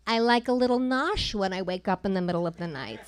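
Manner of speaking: put-on thick New York accent, elderly aunt. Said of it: "in her thick New York accent".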